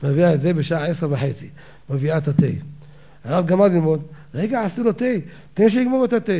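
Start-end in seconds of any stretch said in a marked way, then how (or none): 4.3-6.3 a hint of the wife firmly holding the rabbi back; rebbetzin insisting. Rebbetzin stops the rabbi so the student finishes his tea.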